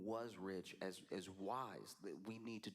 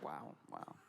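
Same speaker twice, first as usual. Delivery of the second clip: breathy laughing wow under the breath; 9 semitones lower than usual. Real, not acted. Reacting to her own story, a breathy laughing wow. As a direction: laugh the words out with almost no voice.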